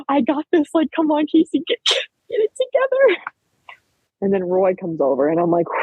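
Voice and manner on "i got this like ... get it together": high, strained voice rising sharply at the end; acted-out attempt to rally through tears; tearful self pep talk. Athlete urging herself to get it together, tearful.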